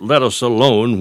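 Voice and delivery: normal talking voice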